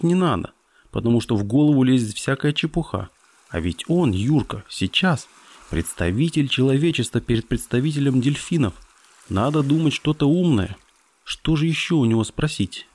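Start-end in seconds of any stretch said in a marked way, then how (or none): none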